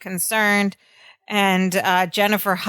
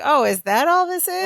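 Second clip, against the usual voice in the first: higher-pitched, lightly acted disbelief; dismissive retold question; 7 semitones higher than usual. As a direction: brush it off as nothing much. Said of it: "Jennifer is sort of on Larry's side".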